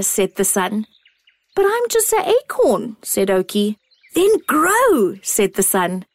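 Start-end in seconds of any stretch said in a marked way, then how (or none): 1.5-3.0 put-on high, small childlike acorn; a tiny wondering acorn. A narrator's reading. 4.1-5.2 bright, calling sun voice; the sun calling down kindly; warm beaming sun voice. A narrator's reading.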